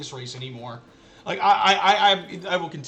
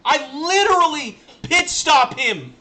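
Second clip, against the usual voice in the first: slow, higher, louder stress on literally; outraged disbelief; 9 semitones higher, 7 dB louder than usual. Real, not acted. Streamer to chat after the bad pit, slow outraged disbelief. Stunned repeat.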